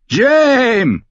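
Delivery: high, loud shouted name; bellowing. Acted. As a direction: bellow across the camp. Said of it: "brüllte ein struppiger Mann".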